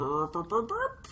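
burp noises leaping up high at the end; imitated game sound. Host guessing at the mystery sound, imitated rising blips. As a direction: imitate a video-game blip with rising burps. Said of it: "that's not even anything like it".

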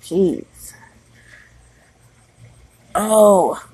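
ordinary delivery

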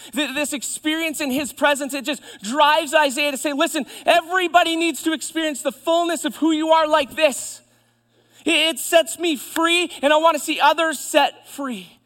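high, loud, fervent declaration; full-force preaching. Real, not acted. Preacher voices Isaiah wanting others set free, full-force preaching. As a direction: proclaim it to the whole room.

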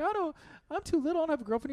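very high little-kid voice, imitated; six-year-old son. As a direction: pitch it way up like a protesting small boy. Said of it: "so he's six".

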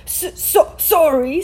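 high, stammered sobbing apology. A narrator's reading. Giant apologises for waking the Muggles, sobbing. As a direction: choke out the word in acted sobs. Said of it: "sobbed Hagrid".

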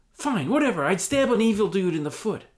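high, loud grudging concession. Acted. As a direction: give in grudgingly. Hobbit gives in.

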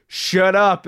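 retold shout from a passerby; understated retold yell; mild mock yell. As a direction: imitate someone calling back, not at full volume. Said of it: "somebody else just yells".

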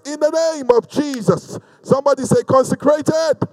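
high, insistent preaching voice; prophetic declaration. Real, not acted. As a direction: declare each blessing over the congregation. Prophetic declarations over congregation.

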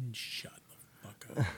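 hushed unvoiced snap; whisper. Mom hissing back at her son, a whispered shut up. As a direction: hiss it under your breath. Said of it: "And she's like".

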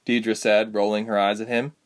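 plain reading voice

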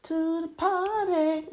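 high, bright show-host hello; sing-song welcome to the party; bubbly host greeting. Acted. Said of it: "Okay, I'm sorry. Let me start again".

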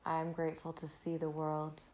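ordinary talk

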